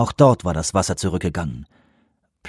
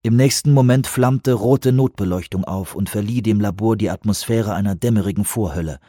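ordinary delivery

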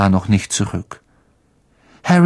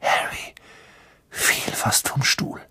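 much softer whispered telling; hushed narration; 9 dB quieter than usual. A narrator's reading. Narrator tells Harry's shock in a much softer whisper. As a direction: breathe it out in suspense.

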